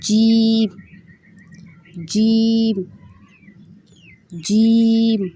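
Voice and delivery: جیم said as a clear, drawn-out model; each جیم sounded carefully for repeating; letter demo. Shown as a demonstration. Teacher modelling جیم for students to repeat. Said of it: "next جو ہے وہ جیم".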